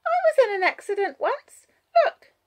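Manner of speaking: put-on high, loud little girl; child's voice. A narrator's reading. Child showing Wallace her scar, high child voice. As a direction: announce it as a small child would.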